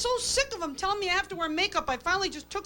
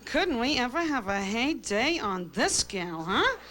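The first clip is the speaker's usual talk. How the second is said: slow, snide catty aside; lightly voiced catty saleswoman; snooty shopgirl whisper; 3 semitones lower, slower than usual. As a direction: sneer like a gossiping salesgirl. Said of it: "She goes".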